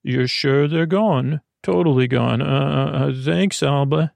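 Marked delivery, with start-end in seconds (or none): none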